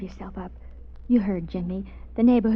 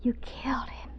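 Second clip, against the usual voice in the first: stunned, hushed whisper; 2 semitones higher, 7 dB quieter than usual. Acted. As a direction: breathe the realization out. Alone with killer, realizing.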